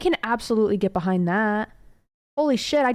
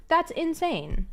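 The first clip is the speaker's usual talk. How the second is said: slower, drawn out; 7 semitones higher, slower than usual. Real, not acted. Streamer repeats her disbelief to chat, slowly. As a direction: stretch out insane slowly.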